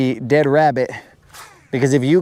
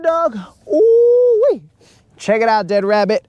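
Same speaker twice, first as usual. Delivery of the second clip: excited greeting; 11 semitones higher than usual. Real, not acted. Greeting a returning viewer.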